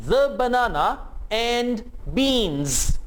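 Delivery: Buy the banana and beans drawn out very slowly; slow teacher demo; slow model sentence. Shown as a demonstration. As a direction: stretch the b sounds for learners. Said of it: "in sentences को मेरे साथ-साथ repeat करें".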